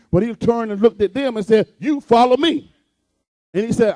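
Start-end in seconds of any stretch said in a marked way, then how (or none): 1.8-2.7 raised, higher-pitched command; acted command. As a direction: give it as a preacher voicing a firm order. Jesus commanding the apostles.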